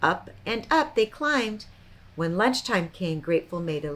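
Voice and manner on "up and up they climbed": plain reading voice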